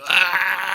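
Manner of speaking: hammed-up "aaah" of horror; mock anguished wail. Mock dismay at fuel cost.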